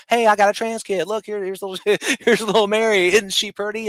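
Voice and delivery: high, bright mock-parent voice showing off a child; mocking a proud parent. Mocking a parent who boasts about their kid, high and gushing.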